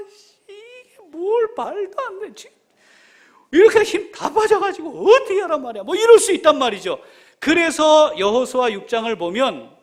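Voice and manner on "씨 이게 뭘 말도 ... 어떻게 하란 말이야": high-pitched, exasperated whine of disbelief; hammed-up exhausted complaint; grumbling protest. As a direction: grumble loudly like a worn-out soldier. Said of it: "우리 같았으면 어땠을까요".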